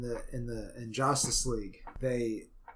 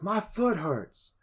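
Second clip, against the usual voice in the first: loud, higher mock complaint; put-on Cyborg line, pitched up and exaggerated; 8 semitones higher, 6 dB louder than usual. Podcaster mocking Cyborg's reshoot line, loud exaggerated complaint. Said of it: "and Cyborg's like".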